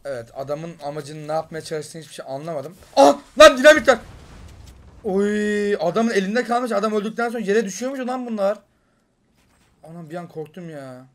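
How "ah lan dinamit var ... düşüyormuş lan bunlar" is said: high, loud surprised discovery; excited surprise. Real, not acted. Gamer surprised to find dynamite dropped by an enemy, excited. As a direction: blurt it out amazed.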